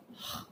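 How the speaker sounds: "h" as a throaty, breathy rasp; French R sound. Shown as a demonstration. Teacher demonstrating the letter R in French, throaty rasp. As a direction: make "h" a scraped ha-kha sound. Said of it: "R is spoken as".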